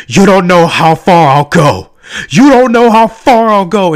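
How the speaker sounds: loud, hammed-up heroine yell; mock defiant shout. Reviewer mimicking the heroine's cringy line, mock defiant shout. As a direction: belt it out like an overacted action heroine.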